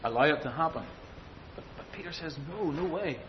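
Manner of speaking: ordinary talk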